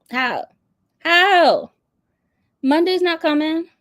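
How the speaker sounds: high, loud disbelieving how; incredulous. Real, not acted. Asking how her sister did not cry, loud disbelief. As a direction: squeal it in disbelief.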